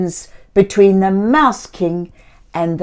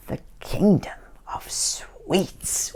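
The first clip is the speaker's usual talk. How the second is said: whispered wonder; 2 semitones lower, 9 dB quieter than usual. Acted. Wondrous place name.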